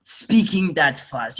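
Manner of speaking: retold indignant denial, starting high and slowing; offended protest. Retelling his reply to listeners' complaints, offended. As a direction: push back, slow and deliberate after a high start. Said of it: "I got actually offended".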